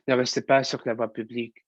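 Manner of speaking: normal talking voice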